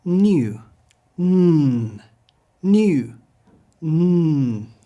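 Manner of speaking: "New" as "nyoo", then "N" as a held nnn; N sound. Shown as a demonstration. Teacher leads repetition, held N. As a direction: alternate "nyoo" with a sustained n hum. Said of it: "sounds like our N".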